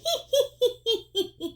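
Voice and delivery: high cackling witch laugh; witch cackle. Shown as a demonstration. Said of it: "Can you laugh like a witch?".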